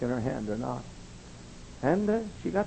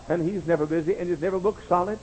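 normal talking voice

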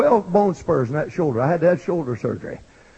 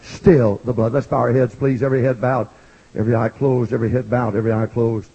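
low, softening invitation; 8 semitones lower than usual. Acted. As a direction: hush them for prayer. Altar call invitation.

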